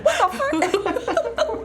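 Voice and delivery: slow, high, laughing through a mock curse; laughing mock outrage. Player laughing while retelling her anger at the roll, mock outrage. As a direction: laugh out your fake fury. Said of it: "I was really mad".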